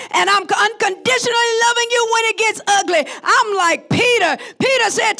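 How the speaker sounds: a fervent vow before the congregation; emphatic preaching. Real, not acted. Preaching a declaration of commitment.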